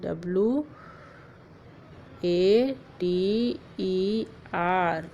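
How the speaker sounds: very slow, letter by letter; spelling out letters. Shown as a demonstration. Teacher spelling water for children, very slow. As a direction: drag out each letter of water. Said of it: "See the word water".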